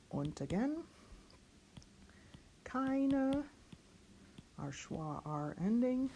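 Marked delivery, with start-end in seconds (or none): none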